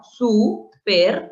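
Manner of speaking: "Super" in two firm, separated syllables; split as prefix. Shown as a demonstration. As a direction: break "Super" off as su, per. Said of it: "Lo común es hacer esto".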